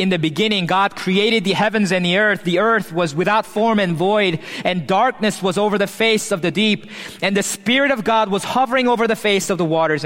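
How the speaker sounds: lifted, formal reading voice; scripture reading. Acted. Pastor reading Genesis to the congregation, lifted and formal. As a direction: read the verses out like a lesson from the pulpit.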